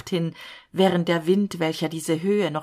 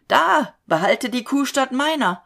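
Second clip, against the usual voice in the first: higher, louder anguished cry; frantic cry; 7 semitones higher, 5 dB louder than usual. A narrator's reading. Falke holds the knife to himself, frantic cry.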